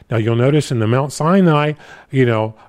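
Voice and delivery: normal talking voice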